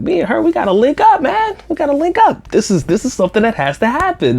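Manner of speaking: hyped to link up with her; super excited. Retold or imitated. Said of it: "Doc is super excited".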